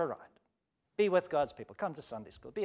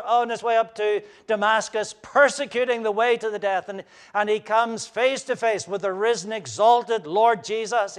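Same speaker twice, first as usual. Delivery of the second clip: loud, high fervent preaching; impassioned sermon delivery; full-force preaching; 6 semitones higher, 10 dB louder than usual. Acted. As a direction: drive the story home from the pulpit.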